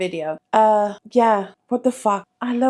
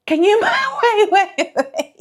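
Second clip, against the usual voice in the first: real laughter breaking through the words, much higher; giggling too hard to get the words out; laughing; 11 semitones higher than usual. Real, not acted. Vlogger cracking up at her own joke, laughing.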